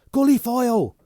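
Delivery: high, loud howl of a name. Acted. Bunny howling Foyle's name to Yeovil, very high and loud. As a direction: howl it out urgently. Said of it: "Bunny howled".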